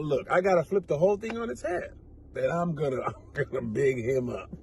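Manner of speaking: scheming thought. Retold or imitated. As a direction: murmur it like a secret con. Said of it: "is gonna figure out".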